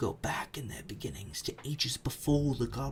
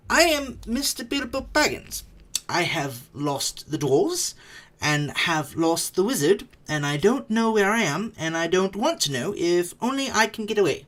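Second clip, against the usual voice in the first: higher, louder, anxious hobbit voice; frightened Bilbo; 8 semitones higher, 8 dB louder than usual. Acted. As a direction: blurt it out, lost and scared.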